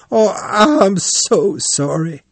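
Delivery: high, drawn-out wailing apology; tearful cry. A narrator's reading. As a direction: sob out the apology, slow and high. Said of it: "he cried".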